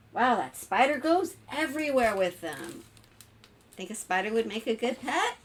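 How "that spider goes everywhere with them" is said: ordinary delivery, nothing marked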